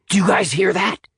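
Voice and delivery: put-on high-pitched, startled boy; sudden alert. A narrator's reading. Dan alerting the group to a noise, sudden. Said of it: "said Dan suddenly".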